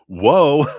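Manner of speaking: loud, high-pitched amazed whoa; real astonishment. Real, not acted. Tarot reader amazed at nine children, loud high exclamation. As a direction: exclaim it big, breaking into a laugh after.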